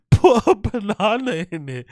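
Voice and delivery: high-pitched and slow, laughing through the words; can barely get it out for laughing; giggling while repeating the joke. Real, not acted. Laughing at chat message.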